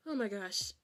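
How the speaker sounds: normal talking voice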